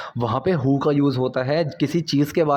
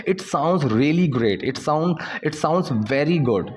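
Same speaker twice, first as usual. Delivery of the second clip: slow, deliberate example sentence; slow teaching demo; much slower than usual. Shown as a demonstration. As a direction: lay each word out slowly. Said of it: "ऐसे हम बोलते हैं". (धीरे-धीरे समझाते हुए)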